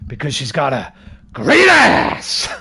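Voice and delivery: slow, loud Pacino-style shout; Pacino shouty quote. Retold or imitated. Imitating Pacino's shouty movie line, slow loud shout. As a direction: bellow it like Pacino on screen.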